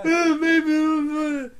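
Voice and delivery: high, slow, dopey mock voice; mocking friend. Mocking his friend's bad joke in a dopey voice. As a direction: drag it out like a fool.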